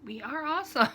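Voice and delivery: playful cheer for her own crafting community; proud cheer. Retold or imitated. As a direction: shout it out proudly. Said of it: "and I'm like".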